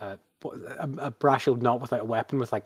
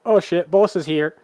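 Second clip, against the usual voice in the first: loud, higher, panicked blurt; the oh-no moment when the boss shows up; alarmed realization; 7 semitones higher, 11 dB louder than usual. Acted. Guard spotting his boss arriving, loud alarmed blurt. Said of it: "gets this look of".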